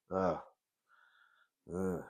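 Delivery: ordinary delivery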